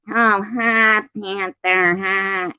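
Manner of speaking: high, loud mocking taunt; high-pitched mocking call at a panther. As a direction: tease the big cat, way up high. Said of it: "maybe if I was just like".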